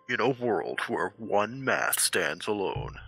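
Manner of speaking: low, slow trailer announcer; movie trailer voice. Acted. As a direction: intone it like an epic trailer.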